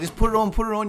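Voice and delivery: urging. Retold or imitated. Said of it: "I was like".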